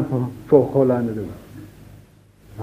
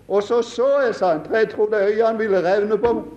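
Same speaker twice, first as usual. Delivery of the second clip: ordinary delivery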